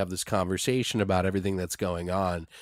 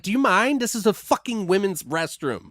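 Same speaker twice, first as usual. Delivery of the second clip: indignant retort; 9 semitones higher than usual. Retold or imitated. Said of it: "She's like".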